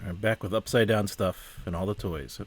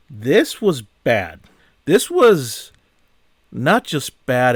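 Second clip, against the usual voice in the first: loud, slow, emphatic condemnation; mad; 4 semitones higher, 8 dB louder, much slower than usual. Real, not acted. Reviewer condemning the film just watched, mad. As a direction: hit each word hard, fed up. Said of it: "I'm mad about this".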